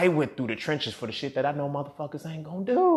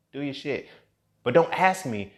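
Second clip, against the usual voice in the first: normal talking voice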